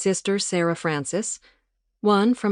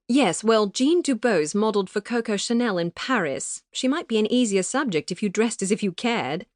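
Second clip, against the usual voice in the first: higher, posh English reproach; prim British friend; 5 semitones higher than usual. A narrator's reading. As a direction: gently scold in a proper English voice. Arabella voiced by narrator.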